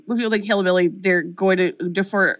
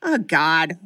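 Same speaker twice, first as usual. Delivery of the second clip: mock casual line read. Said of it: "every time he opens his mouth, it's like".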